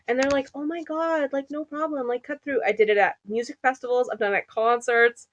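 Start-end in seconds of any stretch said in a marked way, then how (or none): 0.5-2.6 soft, higher mimicked strangers; imitated obliging strangers. Crowd letting her through, softly mimicked. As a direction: quietly mimic people giving way.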